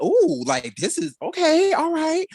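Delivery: high, impressed ooh of surprise; gooped and gagged. Retold or imitated. Host replaying reaction to the promo, gooped and gagged. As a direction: pitch it way up, pleasantly stunned. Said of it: "I was gooped and gagged".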